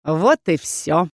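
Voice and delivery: slow, drawn-out villain gloating; cold satisfaction. A narrator's reading. Rebel leader as the dragon leaves, coldly pleased. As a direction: savour the victory coldly. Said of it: "с холодным удовольствием заявил лидер".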